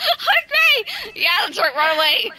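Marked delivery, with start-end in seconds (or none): 0.1-0.8 high hammed-up battle yell; berserk rage. Mock rage while killing with kindness.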